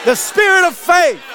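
slow, high, shouted preaching; full-force preaching. Real, not acted. Preacher rouses the congregation to praise, shouting slowly. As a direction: lift the church with each line.

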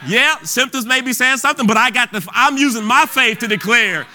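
rising fervour, hammer each claim home; preaching at full force. Acted. Preacher declaring healing to the congregation, full force. Declares victory over sickness.

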